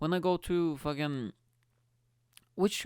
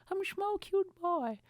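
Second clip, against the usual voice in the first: put-on small cute boy. Comedian pleading he isn't threatening, in a high cutesy voice. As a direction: shrink into a harmless little boy.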